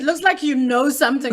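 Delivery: loud, animated teasing challenge; insistent prodding. Real, not acted. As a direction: call them out, loudly. Host teasingly presses a reluctant guest.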